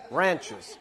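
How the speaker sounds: "Ranchers" in an American accent with a flat "ran" as in "can". Shown as a demonstration. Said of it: "you gotta say them in an American accent".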